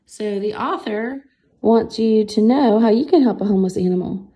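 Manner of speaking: ordinary delivery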